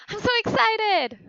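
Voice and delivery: high bright thrilled line; excited. Shown as a demonstration. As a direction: burst with excitement. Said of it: "I was like".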